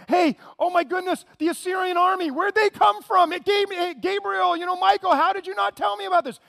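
loud, high, flustered God caught off guard; panicked surprise. Pretend. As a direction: shout it like you just spotted the army at the gate. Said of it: "He's not sitting there going".

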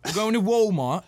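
loud, high British, "Walmart" without L or R; British accent. Shown as a demonstration. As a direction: flatten "Walmart" into mock British. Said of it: "No L".

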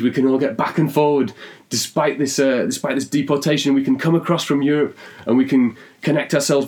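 an announced plan, growing grander as it goes; eureka excitement. Acted. The Stringlishman announcing his idea, excited and rising.

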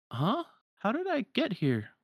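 high-pitched confused voice; puzzled, pitch jumping way up; bewildered. Acted. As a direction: sound baffled at where you are. Confused character regains his senses.

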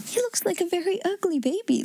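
a touch of mirth; amused, laughing. A narrator's reading. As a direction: say it as if about to laugh. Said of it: "laughed Sarah".

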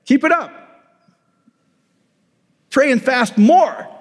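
mock pastor telling the flock to try harder. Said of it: "the pastor then just goes".

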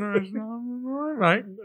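high, mumbled British mumbo; mumbled British accent. Shown as a demonstration. Co-host can only do it mumbling, mumbled British accent. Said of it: "I can only do a British accent when I'm mumbling".

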